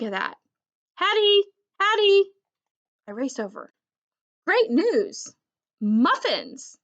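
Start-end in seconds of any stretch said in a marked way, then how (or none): 0.9-2.3 high, loud call of her name. A narrator's reading. Puppy calling for Hattie, loud excited call. 4.5-6.8 excited. A narrator's reading. Excited dog thought.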